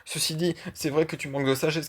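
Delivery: usual speaking voice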